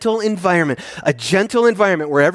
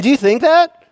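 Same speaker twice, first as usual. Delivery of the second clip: much higher and louder, disbelieving rise; incredulous challenge; 9 semitones higher, 6 dB louder than usual. Real, not acted. Preacher challenging hypocrites in the congregation, incredulous and loud. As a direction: push the question like it's absurd. Pressing the rhetorical question.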